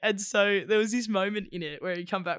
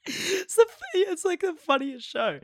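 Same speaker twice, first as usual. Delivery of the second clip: breaking up with real laughter, higher; words cracking through laughter; can barely get it out for laughing; 12 semitones higher, 4 dB louder than usual. Real, not acted. Laughing at her own story.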